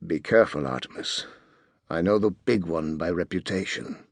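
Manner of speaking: hushed, low-pitched warning; soft, confidential bodyguard undertone; low whisper. A narrator's reading. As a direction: murmur it discreetly into his ear. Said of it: "bent low to whisper".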